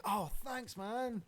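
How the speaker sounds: high, overjoyed thank-you to the troll; mock grateful. Podcasters mock-thanking a troll, high delighted voice. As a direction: gush thanks like you've waited forever. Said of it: "It's like".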